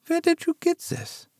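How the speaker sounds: mother startled by the photo; startled whisper-like. Acted. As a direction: caught off guard.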